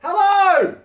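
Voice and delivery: a high, loud called greeting; yell. Acted. Dave calling to the man in the boat, loud and high. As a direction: call out from offshore. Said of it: "he yells".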